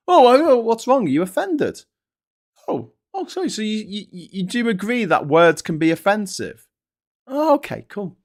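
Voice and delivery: high, sing-song sarcastic taunt; mocking sarcasm. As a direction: needle them with fake sweetness. Mocking the offended elder.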